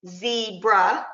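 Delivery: Zebra said as two separate syllables; split into syllables. Shown as a demonstration. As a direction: say Zebra as ze, then bra, for blending. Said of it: "for the syllables".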